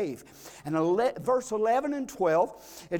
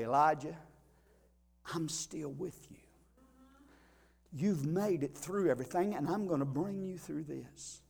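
hushed, much softer and lower; God's whisper; 5 semitones lower, 9 dB quieter than usual. Acted. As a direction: breathe it as a gentle whisper. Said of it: "could whisper to the prophet".

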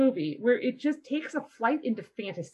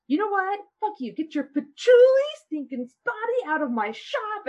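retold yell; 9 semitones higher, 4 dB louder than usual. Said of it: "And he, like, yells at him".

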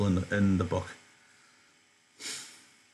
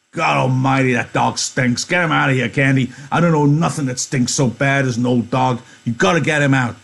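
loud and disgusted, higher pitched; loud disgusted Carlson; 5 semitones higher, 6 dB louder than usual. Acted. Carlson ordering Candy to remove the stinking dog, loud. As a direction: shove the complaint at him.

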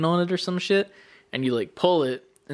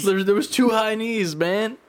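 higher-pitched mock complaint; indignant protest; 7 semitones higher than usual. Player argues over two lost reps, mock indignant. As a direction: insist on it in mock outrage.

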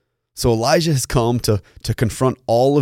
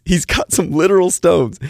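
real laugh running into higher, louder words; amused; 8 semitones higher, 5 dB louder than usual. Real, not acted. Preacher jokes about Elijah's stones to the congregation, amused. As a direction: crack up at your own joke. Laughing at his own joke.

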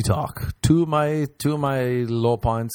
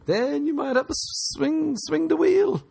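high mocking. Joke punchline, high.

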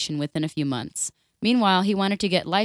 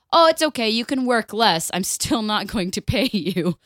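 mock boss; 3 semitones higher, 5 dB louder than usual. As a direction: sneer it like a cheap boss. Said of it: "And you're like".

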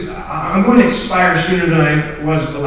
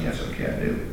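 hushed reply; 11 dB quieter than usual. Retold or imitated. Retold answer to God.

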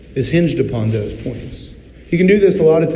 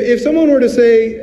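normal talking voice

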